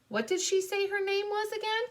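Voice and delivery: high-pitched questioning voice; puzzled godmother. Acted. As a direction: ask in a high, puzzled little voice. Puzzled godmother asks the name.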